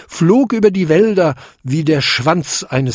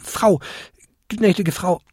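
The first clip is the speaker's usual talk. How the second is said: high, small, hesitant kobold voice; stammering apology; 7 semitones higher than usual. A narrator's reading. Kobold apologises to the offended old lady, stammering. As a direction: grope for the right title, halting and high. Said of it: "stammelte der kleinste Winterkobold".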